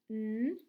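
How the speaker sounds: N as a held "nnn", not the letter name; letter-sound demo. Shown as a demonstration. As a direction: hum the bare sound of N. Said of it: "K N says".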